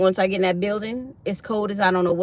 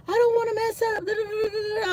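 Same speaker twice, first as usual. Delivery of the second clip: high mock whine running into babble; imitated freak-out. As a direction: whine it in a squeaky panic. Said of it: "She was freaking out".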